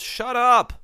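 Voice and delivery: high, loud retold yell; yelled outburst. Retelling a contestant snapping at friends trying to calm him, yelled. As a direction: yell it as if storming off. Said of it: "he yells".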